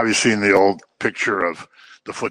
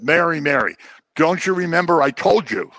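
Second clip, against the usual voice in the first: scolding; 6 semitones higher, 4 dB louder than usual. Retold or imitated. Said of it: "kind of a scolding".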